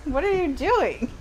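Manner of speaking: high, amused disbelief; laughing at the author's choice; incredulous. Acted. Reader mocking an author's choice, high and amused.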